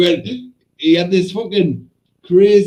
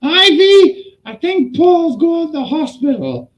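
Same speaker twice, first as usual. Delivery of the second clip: high, mimicked alarm down the phone; urgent phone caller; 11 semitones higher, 3 dB louder than usual. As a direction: act out breaking bad news, pitched up. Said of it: "You gotta phone Ivy".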